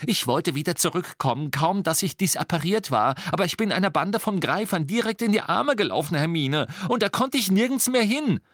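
high, pleading rush of excuses; agitated self-defence; urgent explanation. A narrator's reading. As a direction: plead your case, pitch climbing. Ron urgently defends himself to Hermine.

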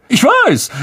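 high, loud smug agreement; boastful Lockhart. Acted. Lockhart brags to Harry, high and smug. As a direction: preen over your fame.

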